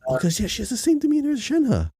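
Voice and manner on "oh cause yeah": normal talking voice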